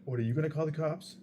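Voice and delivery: high, fast mocking dare; mocking challenge. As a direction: taunt him back, fast and high. Drunk guy challenging him.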